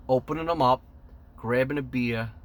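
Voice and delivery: usual speaking voice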